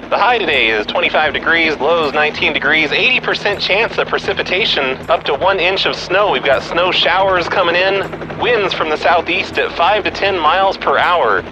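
high-pitched news chopper reporter; helicopter voice. Acted. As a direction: read the weather like a traffic chopper reporter. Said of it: "uh, helicopter voice".

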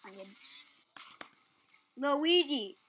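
ordinary talk, nothing marked

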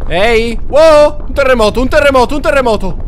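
loud high excited yelling; shouting in alarm. Real, not acted. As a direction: shout it out, thrilled and scared. Terremoto, grida. (urla eccitate e spaventate)

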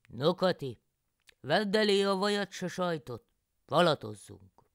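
loud, cheery country husband; farmer János. A narrator's reading. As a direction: play the hungry husband calling out to his wife. János speaks to wife.

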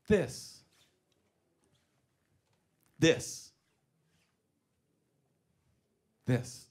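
hushed, spaced-out dramatic build; savouring the moment. Acted. Savours the thought before punchline.

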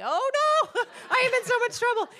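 high, loud laughing mock panic; cracking up at being caught out. Real, not acted. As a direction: squeal it out through real laughter. Laughing at the friend's remark.

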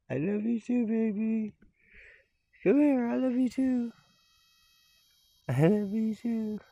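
high, tender pet talk. Real, not acted. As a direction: coo love at the cat. Baby talk to the cat.

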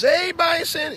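very high sing-out to an animal; mock tourist calling. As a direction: call the bison in a high, silly voice. Acting tourists petting a bison.

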